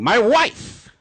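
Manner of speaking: high, loud shouted answer; mock shout. Don snapping back about who he's calling, mock shout. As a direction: blurt it like an exasperated husband.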